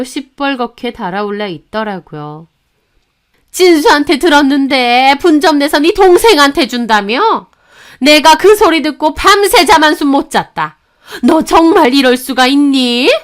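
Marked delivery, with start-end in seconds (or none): 3.5-13.1 loud, high-pitched outburst from the mother-in-law; angry yelling. Acted. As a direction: confront the daughter-in-law at full volume. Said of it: "버럭 소리를 질렀습니다". (버럭 화내며 따지는 시어머니)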